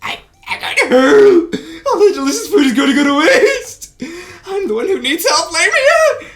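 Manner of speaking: high, loud strangled cries and laughs; squeezed panic. Acted. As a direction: yelp for help while being crushed. Being crushed in a hug.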